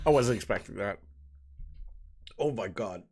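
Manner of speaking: strained, choked from coughing; coughing on smoke. Real, not acted. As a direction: talk after smoke went down wrong pipe. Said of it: "The smoke went down the wrong pipe".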